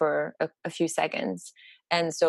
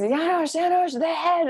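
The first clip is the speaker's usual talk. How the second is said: high, urgent call for someone in the next room; urgent mock call; 11 semitones higher than usual. Calling the father in for the head, high and urgent. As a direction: call out excitedly, pitched well up.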